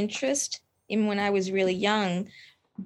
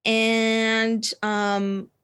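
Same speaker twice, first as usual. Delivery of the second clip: ordinary talk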